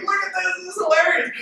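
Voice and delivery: squealing high, delighted; high, giggly call to look; high-pitched excitement. Retold or imitated. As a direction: voice jumps up, giddy and amused. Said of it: "I was like".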